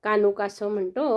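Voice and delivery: usual speaking voice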